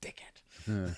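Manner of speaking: a low whispered jab at a friend; whispered insult. Real, not acted. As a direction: whisper it low, half to yourself. Muttered insult about the host.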